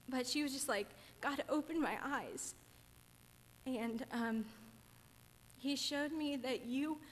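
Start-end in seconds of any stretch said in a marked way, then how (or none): 1.2-7.0 slow and halting, close to crying; tearful, drawn-out phrases with long pauses; holding back tears. Real, not acted. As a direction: push on through real tears, pausing to recover. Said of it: "I wasn't planning on crying".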